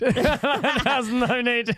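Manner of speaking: high, drawn-out words through laughter; laughing. Real, not acted. Cracking up at retold insult.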